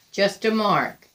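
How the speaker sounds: plain reading voice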